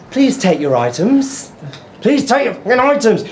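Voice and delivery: mock patronising automated checkout voice; drawn out, patronising robot prompt; patronising machine. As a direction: imitate a nagging, condescending self-checkout. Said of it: "So patronising".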